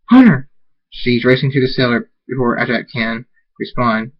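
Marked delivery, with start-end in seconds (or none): 0.1-0.5 loud, high acted cry of a name; alarmed call. Character calls out in alarm.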